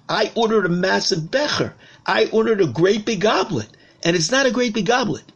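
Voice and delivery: the king's annoyance builds again; mildly indignant king. Acted. King scolding the goldsmith, his annoyance building. King complains about small goblet.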